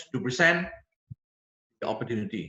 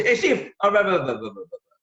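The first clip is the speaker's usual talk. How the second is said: tongue-tied caller; 4 semitones higher, 3 dB louder than usual. Shown as a demonstration. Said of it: "Don't know what to say".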